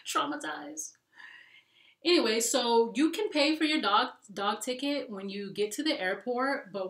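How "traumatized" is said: whispered then high, breathy moan; dramatic whine. Retold or imitated. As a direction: play it up as deeply traumatized. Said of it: "But I'm like".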